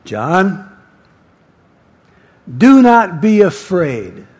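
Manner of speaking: big commanding divine voice; Jesus. Acted. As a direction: call the name, pause, then declare it loudly. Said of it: "It's a big voice".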